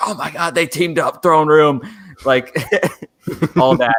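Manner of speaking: high, eager gushing; hyped fan. Retold or imitated. Fan recalling first viewing, high and eager. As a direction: gush with adrenaline.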